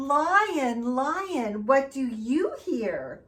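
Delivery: sing-song storybook read; reading to children. Acted. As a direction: read it out to the little ones. Reads picture book aloud.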